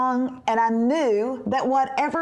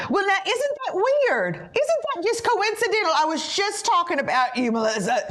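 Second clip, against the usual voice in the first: imitating her mother; 9 semitones higher than usual. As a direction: ham up mom's fake innocence. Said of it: "She's talking like this".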